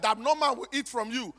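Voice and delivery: usual speaking voice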